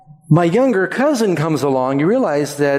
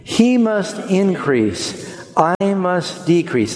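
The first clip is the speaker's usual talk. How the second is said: slow, He stressed and raised; slow recital with the congregation; 4 semitones higher, slower than usual. Shown as a demonstration. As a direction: lead them through the verse slowly. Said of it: "Say it with me".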